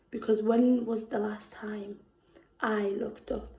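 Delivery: soft unvoiced whisper; whispering a question to herself in the poem. Poem line drops to whisper.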